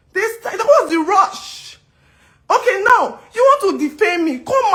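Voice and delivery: high, slow, drawn-out disbelief; incredulous what's the rush; exasperated. Real, not acted. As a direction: stretch it out in exasperation. Exasperated rhetorical question.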